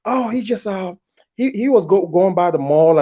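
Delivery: high, mock innocent girlfriend voice; excuse-making girlfriend. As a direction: play her fumbling for an excuse.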